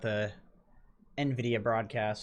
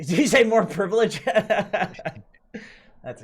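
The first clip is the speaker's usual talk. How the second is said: laughing; 8 semitones higher, 9 dB louder, much slower than usual. Real, not acted. Laughing at the privilege joke.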